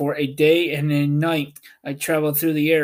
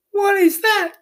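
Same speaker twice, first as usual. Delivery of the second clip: put-on high, girlish and louder; curious Dorothy; 5 dB louder than usual. A narrator's reading. Dorothy asking what a balloonist is, high girlish voice. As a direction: ask like an eager little girl. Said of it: "asked Dorothy".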